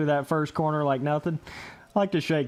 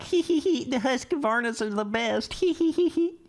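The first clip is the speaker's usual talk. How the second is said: mocking giggly voice; 10 semitones higher than usual. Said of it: "They're like".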